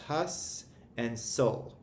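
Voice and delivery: "hus" and "sul" as slow, separate syllables; broken into syllables. Shown as a demonstration. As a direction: stretch out "hus", pause, then "sul". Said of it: "we're breaking it into two syllables".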